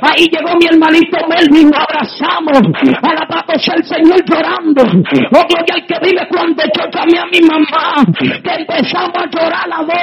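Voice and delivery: fiery preaching. Real, not acted. Preacher testifying to the congregation, full-force preaching. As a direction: preach it out with building fervor.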